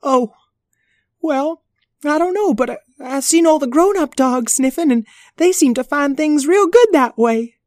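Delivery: high, eager little puppy voice. Acted. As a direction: pitch way up like a small pup. Howdy Pooch and Patty Pan banter.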